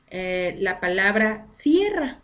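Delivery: slow down and land on cierra; slow emphasis. Shown as a demonstration. Teacher to class, introducing the example word cierra slowly. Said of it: "le puedo dar una connotación completamente diferente".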